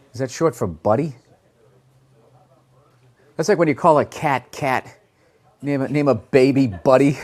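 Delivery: ordinary talk, nothing marked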